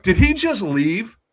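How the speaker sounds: high, loud, slow question; stunned disbelief. Retold or imitated. Bartender realizes the fan skipped out, high disbelief. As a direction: ask as if you can't believe it.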